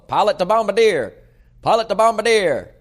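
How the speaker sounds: loud, high hammed-up pilot radio call; mock radio call. Host joking that the pilot episode is a bomber flight, loud mock radio call. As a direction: bark it like a wartime pilot on the radio, in jest.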